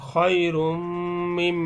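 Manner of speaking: "খয়রুম মিম" slow, joined by a long m; ghunnah recitation. Shown as a demonstration. Teacher showing mim after tanween, held hum. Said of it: "তানবিনের পরে মিম".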